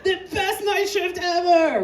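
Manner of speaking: high, thrilled whoop; celebrating a wild night shift; delighted whoop. Retold or imitated. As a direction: cheer like it's the best night. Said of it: "I'm like".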